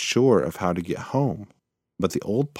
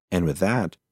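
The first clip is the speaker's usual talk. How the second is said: usual speaking voice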